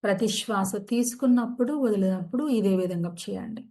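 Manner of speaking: calm, unhurried meditation guide; soothing yoga nidra guidance; guided meditation. Acted. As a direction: ease listeners into stillness.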